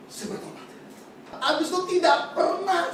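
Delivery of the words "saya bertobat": breathy whisper of repentance; whispered confession. Real, not acted. Preacher confessing his repentance to the congregation, whispered. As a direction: hush it, almost no voice.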